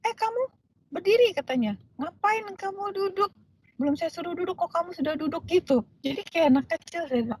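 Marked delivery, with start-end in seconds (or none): 0.0-1.3 high-pitched mock telling-off; retold scolding. Lecturer ordering a seated student to stand, high retold scolding. 1.9-5.5 retold scolding. Lecturer to student who sat unasked, high-pitched retold scolding.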